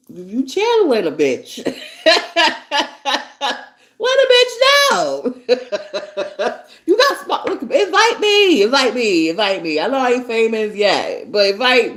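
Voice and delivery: high, loud, laughing invitation; laughing plea. Real, not acted. Teasing Angie to invite her on the show, laughing. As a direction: beg through laughter.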